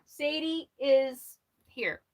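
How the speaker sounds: ordinary delivery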